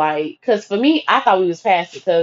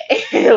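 short laugh, pitched up; high burst of laughter. Real, not acted. Teller laughing at the phone fight memory. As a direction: laugh at your own story.

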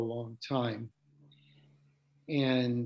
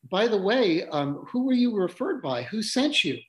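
curious couple; 8 semitones higher, 5 dB louder than usual. Retold or imitated. Couple asks who referred her.

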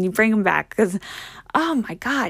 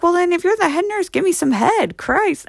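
higher-pitched imitation of a cheeky dad; heckling dad; 9 semitones higher than usual. Daughter mimics dad heckling the head nurse, cheekily. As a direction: toss it back at her as Pop would.

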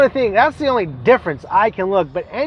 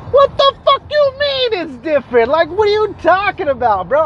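much higher, indignant what-the-hell; real outrage at a dumb excuse; incredulous outburst; 9 semitones higher than usual. Real, not acted. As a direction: blurt it out in disbelief, pitch jumping up. Outraged at guy's gas excuse.

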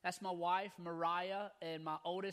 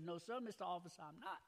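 softly voiced Louisiana drunk, dropped r's; Louisiana accent; 2 semitones lower, 8 dB quieter than usual. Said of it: "I'll throw a little accent in for you".

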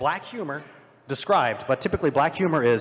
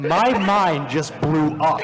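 amazed; 4 semitones higher, 6 dB louder, much slower than usual. Real, not acted. Reacts to the The Room joke.

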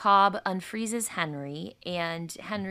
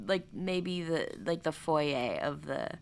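normal talking voice

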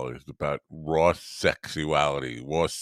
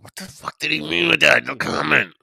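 loud, high, baffled outburst; baffled muttering; 10 semitones higher, 7 dB louder than usual. Retold or imitated. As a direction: voice a confused rant. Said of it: "I'll be walking around like".

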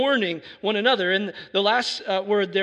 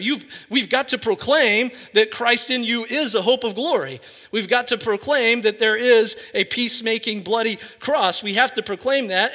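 higher, louder revival preaching; preaching at full force; 4 semitones higher, 3 dB louder than usual. Acted. Pastor urging his church to proclaim Christ, full-force preaching. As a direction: proclaim it to the back row.

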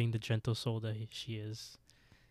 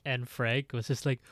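ordinary delivery

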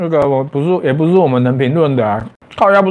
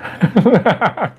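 high embarrassed laugh; nervous laugh; 6 semitones higher than usual. Real, not acted. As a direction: laugh at own joke. Joking about donation.

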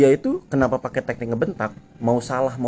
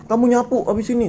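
high, loud bossy order; mock officer bark; 12 semitones higher, 5 dB louder than usual. Officer orders the rider to sweep, high loud bark. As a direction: hurry him along like a pushy officer. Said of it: "Gue digas".

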